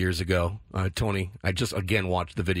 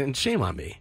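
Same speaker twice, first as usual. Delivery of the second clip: ordinary talk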